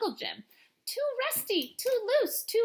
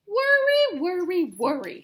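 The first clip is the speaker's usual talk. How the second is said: grandmother; 6 semitones higher, 6 dB louder than usual. A narrator's reading. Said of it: "said her grandmother".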